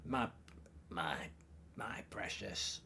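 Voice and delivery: slow, soft creature voice attempt; badly faked creature voice, hushed and halting; Gollum. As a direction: struggle through a quiet, drawn-out impersonation. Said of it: "I can't do Gollum".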